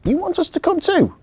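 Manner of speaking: put-on a little girl who can't believe it; surprised girl. Victoria is surprised to be invited, high.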